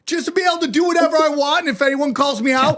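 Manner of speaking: high, mock-gleeful daydream; a high, mocking dream of getting away with anything; sarcastic glee. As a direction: gush sarcastically about never being criticized. Sarcastic fantasy of immunity.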